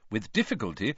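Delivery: usual speaking voice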